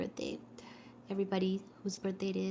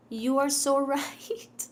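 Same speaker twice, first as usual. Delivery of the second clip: amused, drawn-out agreement; laughing; 4 semitones higher, 5 dB louder than usual. Real, not acted. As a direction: laugh through the words, slowly.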